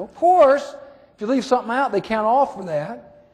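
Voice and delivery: put-on high, obvious child's answer; a kid stating the obvious; young boy. Chad answering the sheriff, high and matter-of-fact.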